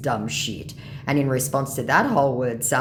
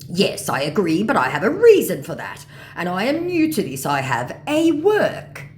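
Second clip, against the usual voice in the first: raised voice, slowing at the end; excuse-making mock scammer; 6 semitones higher, 6 dB louder than usual. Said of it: "he says".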